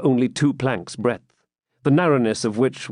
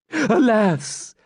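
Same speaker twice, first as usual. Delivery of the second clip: high, wailing cry of alas; thin, anxious old man's lament; 11 semitones higher than usual. A narrator's reading.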